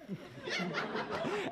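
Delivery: high, soft breathy laugh; a burst of amused laughter. Real, not acted. Narrator laughing.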